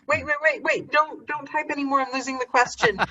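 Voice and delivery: high-pitched, flustered plea to stop; real fluster, half amused; mock-panicked plea. Real, not acted. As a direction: plead for them to wait, pitch jumping up. Chat scrolling away, losing the question.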